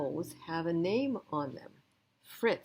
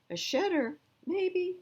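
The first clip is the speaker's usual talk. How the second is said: much higher, a fussy question then a shrug; high doubtful visitor voices; 12 semitones higher than usual. Acted. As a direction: toss it off like a choosy shopper. People judging dogs at shelter.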